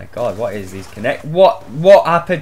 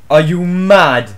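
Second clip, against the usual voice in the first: ordinary talk, nothing marked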